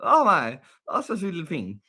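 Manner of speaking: high-pitched cooing delight; adoring gush. Real, not acted. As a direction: gush over a naughty little darling. Gushing over naughty monkey.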